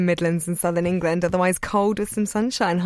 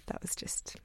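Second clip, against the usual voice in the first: whispering; 19 dB quieter than usual. Real, not acted. Hushed aside on air.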